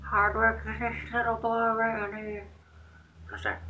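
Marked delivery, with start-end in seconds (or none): 0.0-2.5 put-on high, sweet old lady praising the boy; old folks' voice. Old residents praising the housekeeper in a high, sweet voice. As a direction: coo it like a kindly grandma. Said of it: "They're like".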